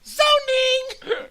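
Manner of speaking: loud, very high, crying-like squeal; high squeal twist. Pretend. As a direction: wail it like a parody. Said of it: "put a little twist to it".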